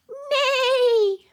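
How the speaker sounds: high, loud, drawn-out neigh; whinny out like a horse. Pretend. Teacher giving a clue about the animal in the stable, loud neigh. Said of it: "It sounds like this".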